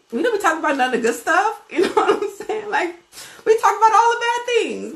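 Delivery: high, loud, laughing through the words; chuckling at our own gossip; laughing aside. Real, not acted. The preacher laughs at how we only talk about bad things, high and amused.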